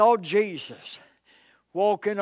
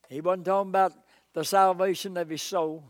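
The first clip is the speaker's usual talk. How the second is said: usual speaking voice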